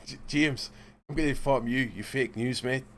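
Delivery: a mock Scottish accent, admittedly poor; lightly put-on Scottish accent, imitating the guest. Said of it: "my Scottish accent is shit".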